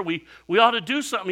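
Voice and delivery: high, loud, fast panic; panicked hurry. Retold or imitated. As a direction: rush the words out in alarm. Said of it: "They're like".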